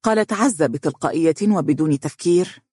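ordinary delivery

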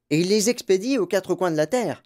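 high, put-on old woman's voice; animated grandma, lifted pitch; eager grandmother. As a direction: chime in eagerly, seeking agreement. Reader voices grandmother Joséphine.

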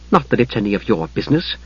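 coolly superior, understated; lofty. A narrator's reading. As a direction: look down on him as you say it. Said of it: "said to Steele loftily".

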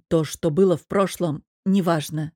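plain reading voice

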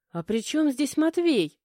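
sudden high, loud disbelief; stunned. A narrator's reading. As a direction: blurt it out in shock. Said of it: "вскинув на меня ошарашенный взгляд".

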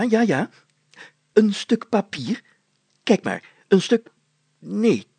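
high, loud, eager mayor voice; excited mayor. A narrator's reading. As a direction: fuss over the find, breathless with excitement. Mayor character voice held. (opgewonden burgemeester)

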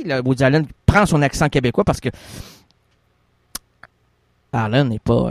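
ordinary delivery

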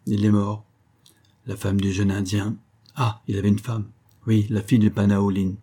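plain reading voice

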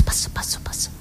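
fading to a bare whisper. Shown as a demonstration. Showing grandfather lowering the secret radio, a whisper. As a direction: whisper it down to nothing, like turning the dial. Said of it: "Metteva il volume basso".

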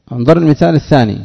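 normal talking voice

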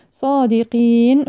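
slow صادقين with a long ii before the final n; lengthened final vowel. Shown as a demonstration. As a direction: stretch the ii of صادقين and stop on the n. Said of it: "عارض للسكون". (مد الياء في صادقين عند الوقف)

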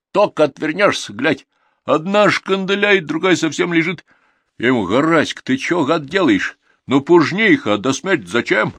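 high, loud peasant complaint; aggrieved, animated old countryman; indignant villager with a grievance. Acted. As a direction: rant about the neighbour, drawing words out.

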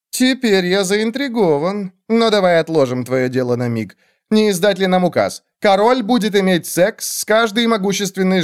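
a hint of a monarch grandly decreeing; intrigued king. King announces an absurd decree to his court, intrigued and lightly declaiming.

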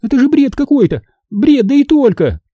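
high, fast, indignant protest; high-pitched cry of disbelief; indignant outcry. A narrator's reading. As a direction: rattle it off high, scandalised. Minди keeps crying out in protest.

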